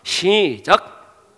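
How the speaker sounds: ordinary delivery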